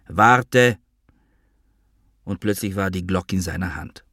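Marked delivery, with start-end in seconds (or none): 0.1-0.8 higher, raised call. A narrator's reading. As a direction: call to stop someone leaving. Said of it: "rief er, während ich langsam ausstieg". (lauter Zuruf)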